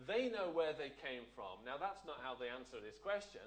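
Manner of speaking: ordinary talk, nothing marked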